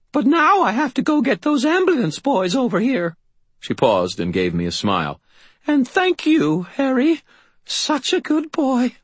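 put-on high old woman's voice, slowing at the end; a fond old lady, hurried then tender; old landlady. Landlady thanks Harry before rushing off, high and fond.

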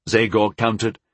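ordinary delivery, nothing marked

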